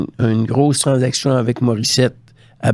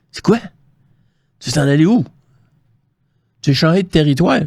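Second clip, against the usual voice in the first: higher-pitched, incredulous questions; taken aback; 5 semitones higher, faster than usual. Retold or imitated. General manager to departing scout, taken aback. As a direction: question it in rising disbelief. Boss reacts to his wish to leave.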